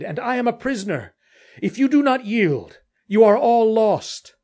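loud herald-like hail; calling out a warning. Acted. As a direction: call the grim news across the dark.

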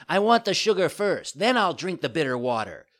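high, loud bargaining puppet; demanding child. A narrator's reading. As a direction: insist loudly like a spoilt child.

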